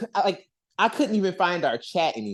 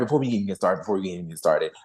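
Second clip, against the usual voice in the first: much lower, playful booming voice; mock deep voice; 10 semitones lower, much faster than usual. Host to co-hosts, teasing in a mock deep voice. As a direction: drop the voice way down for a joke. Repeats phrase dropping voice low.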